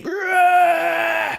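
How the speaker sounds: high, loud roaring scream; inhuman scream. Acted. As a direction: scream like a beast. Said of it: "this inhuman scream".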